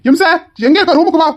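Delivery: high, loud call across the house; husband calling his wife loudly to the room. Acted. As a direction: shout for your wife from the other room. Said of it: "நான் என் பொண்டாட்டியை தான் கூப்பிட்டேன்".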